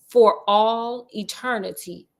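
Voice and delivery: ordinary delivery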